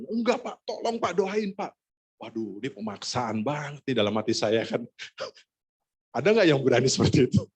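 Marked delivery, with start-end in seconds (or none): 0.1-1.8 high-pitched insistent begging; imitating a desperate wife; pleading wife. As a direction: beg urgently in a raised pitch. Wife begs him to pray again.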